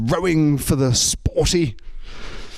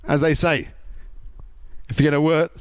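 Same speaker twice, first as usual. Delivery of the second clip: usual speaking voice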